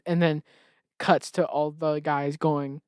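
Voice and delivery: ordinary talk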